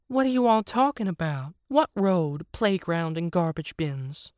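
high put-on hippo voice. Hippo questions the others' plan, in a high put-on voice. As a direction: question them in a high character voice, easing down at the end.

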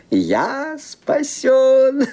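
slow exultant self-congratulation; triumphant relief. Acted. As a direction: savour every word of the victory. Villain exults at his success.

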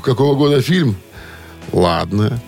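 ordinary talk

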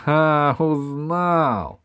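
loud, high gloating cry; gleeful. Acted. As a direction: crow with delight. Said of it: "обрадовался епископ". (злорадная радость)

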